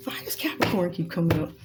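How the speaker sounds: hushed whisper to herself; whispered aside. Real, not acted. Tarot reader wondering aloud to herself, whispered. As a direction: breathe it out as a puzzled secret.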